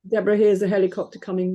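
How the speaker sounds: normal talking voice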